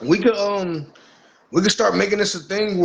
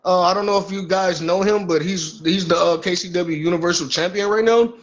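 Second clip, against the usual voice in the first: normal talking voice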